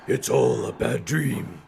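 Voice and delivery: slow silly monster murmur; dumb monster voice. Acted. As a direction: drag it out like a dim monster. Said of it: "this dumb monster voice".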